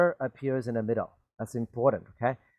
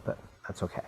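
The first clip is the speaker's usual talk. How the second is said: whispered aside; 6 semitones lower, 12 dB quieter, much faster than usual. Real, not acted. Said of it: "Maybe I shouldn't say that".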